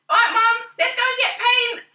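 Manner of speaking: put-on loud, very high teen call; mimicked eager daughter. Mum imitates daughter demanding a paint trip, loud high eagerness. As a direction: imitate a daughter rallying mum, pitched right up.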